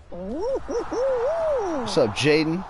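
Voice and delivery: very high, drawn-out falsetto "what's up"; squeaky greeting. Pretend. Streamer greets his chat in a squeaky falsetto. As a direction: stretch the greeting way up high and silly.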